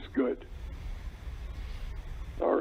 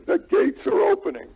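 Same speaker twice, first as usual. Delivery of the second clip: excited; 9 semitones higher, 4 dB louder than usual. Real, not acted. Repeats call, second bigger.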